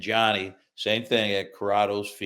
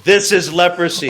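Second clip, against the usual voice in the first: mock emphatic mother; 9 semitones higher, 12 dB louder than usual. Mother showing picture.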